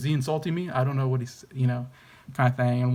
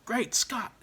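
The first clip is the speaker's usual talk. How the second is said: much higher, exclaimed Great Scott in a put-on voice; Doc Brown impression; 10 semitones higher, 3 dB quieter than usual. Reviewer performs the signature line in Doc's voice. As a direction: shout it like the wild-eyed scientist. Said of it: "kind of a signature line".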